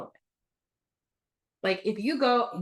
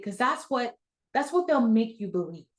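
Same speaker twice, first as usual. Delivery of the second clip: normal talking voice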